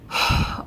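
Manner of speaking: long breathy sigh of disbelief; exasperated sigh. Retold or imitated. Customer facing yet another delay, exasperated sigh. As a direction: sigh out the frustration.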